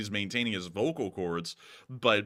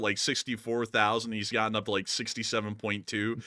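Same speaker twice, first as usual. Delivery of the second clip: usual speaking voice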